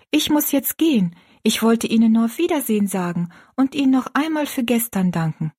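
put-on high, polite girl's voice; grateful young girl. Girl thanking the woman before leaving, grateful. Rosalie's character voice.

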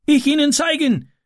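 put-on high foreign-accented boy's call; Ram calling. A narrator's reading. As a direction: offer help eagerly in broken German. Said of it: "rief Ram".

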